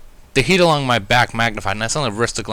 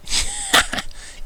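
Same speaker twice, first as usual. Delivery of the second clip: breathy high giggle from a friend; giggling laugh. Acted. Friend giggling at the wet narrator, high breathy laugh.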